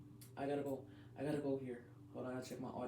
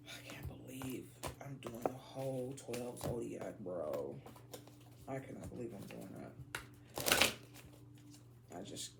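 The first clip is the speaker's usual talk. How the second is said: whisper; 4 semitones lower, 6 dB quieter than usual. Real, not acted. Stunned at doing all twelve.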